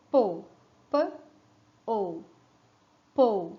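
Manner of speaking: "po" split into "p" and "o", then blended again; sounding out. Shown as a demonstration. Teacher showing the children how p and o make po, sounded out. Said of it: "E pra fazer po".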